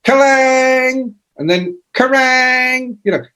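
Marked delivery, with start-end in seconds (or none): 0.0-1.1 high ringing shout of a guitar chord; guitar crash shout. Retold or imitated. Rock writers answering the phone, high shouted guitar-crash noise. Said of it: "we used to go". 1.9-3.0 high ringing shout of a guitar chord; guitar crash shout. Retold or imitated. Rock writers answering the phone, high shouted guitar-crash noise. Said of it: "we used to go".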